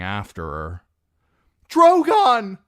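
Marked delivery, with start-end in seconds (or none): 1.7-2.6 high, loud scream of the dragon's name. Acted. Dany calling her dragon across the pit: high, loud scream. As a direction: call him with everything. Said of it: "she screamed".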